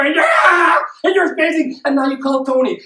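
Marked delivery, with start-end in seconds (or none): 0.2-0.8 loud, high celebratory scream; excited yell. Pretend. Lottery player seeing the final number, excited yell. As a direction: explode in a jackpot scream. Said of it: "And you're yelling".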